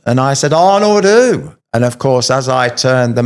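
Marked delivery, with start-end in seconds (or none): 0.5-1.5 higher, louder and slow, baffled; surprised question. Retold or imitated. Trainer puzzled at the name of his friend's uncle, a surprised slow question. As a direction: stretch it out in disbelief. Retold surprise at meeting Arnold.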